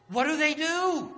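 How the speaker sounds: high, loud sudden call to the crowd; big preacher's exclaim; rising rhetorical cry. Real, not acted. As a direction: throw the question out to the congregation.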